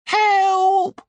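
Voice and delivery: high, loud cry for help; shouted for help. Acted. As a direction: yell it out, desperate. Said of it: "Sid shouted".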